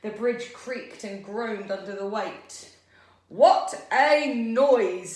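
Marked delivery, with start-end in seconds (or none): 3.3-5.2 very loud, drawn-out, higher troll bellow; meanest troll voice. A narrator's reading. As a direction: bellow it very slowly as the mean troll. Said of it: "said the troll in his meanest voice".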